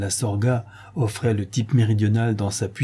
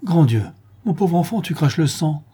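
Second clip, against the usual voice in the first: anguished tenderness; 6 semitones higher than usual. A narrator's reading. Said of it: "d'une voix palpitante de tendresse et les traits empreints d'angoisse".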